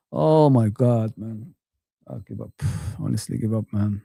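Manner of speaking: deflated, giving up; sighing, defeated and soft; quiet resigned sigh. Real, not acted. Fan despairing over his club.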